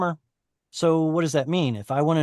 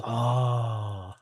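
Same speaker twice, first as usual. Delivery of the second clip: awed reaction; 3 semitones lower, 3 dB quieter than usual. Acted. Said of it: "And everyone goes".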